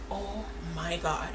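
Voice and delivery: very soft, slow disbelief; stunned hush. Retold or imitated. Storyteller reacting to seeing the girl backstage, stunned hush. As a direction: say it slowly under your breath, stunned.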